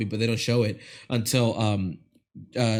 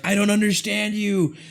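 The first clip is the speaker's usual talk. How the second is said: high, mock-puzzled hero; 9 semitones higher than usual. Retelling the movie hero talking to a zombie, high mock voice. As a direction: pitch it up like a clueless action hero. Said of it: "Dave Bautista's like".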